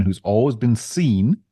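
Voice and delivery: "seen" drawn out with rising pitch; stress on SEEN. Real, not acted. Host qualifying a councillor's reputation, stress on "seen". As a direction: lean slowly on the word "seen". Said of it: "I stress the word seen".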